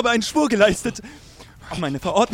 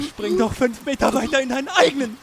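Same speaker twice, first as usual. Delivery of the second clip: normal talking voice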